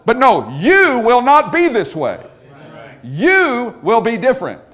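"you" punched high, loud and long; emphatic YOU. Shown as a demonstration. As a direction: preach it, leaning slowly on each "you". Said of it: "That's the idea of the emphatic personal pronoun".

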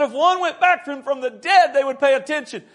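high, loud pleading; desperate arguing. Acted. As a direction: insist at full pulpit pitch. Rich man argues desperately from hell.

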